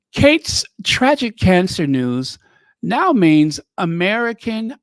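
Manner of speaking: theatrical read-aloud with swooping pitch; dramatic mock reading. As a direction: declaim the overwrought prose with relish. Reads a florid article aloud.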